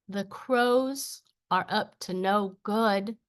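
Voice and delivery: measured verse with long pauses; deliberate spoken-word recital; poem reading. Acted. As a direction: let each image land before the next line. Reads own poem aloud.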